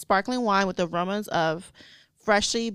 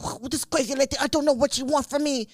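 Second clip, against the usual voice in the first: imitating French mover; 5 semitones higher than usual. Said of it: "he was just like".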